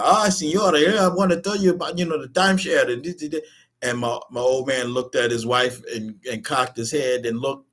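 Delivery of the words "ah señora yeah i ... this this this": mock Mexican salesman. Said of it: "Mexican guy".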